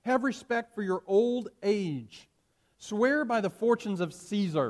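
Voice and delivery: a hint of a coaxing Roman official, slow at first; lightly voiced proconsul urging the old man; persuading official. Proconsul urging old Polycarp to swear, mildly pleading.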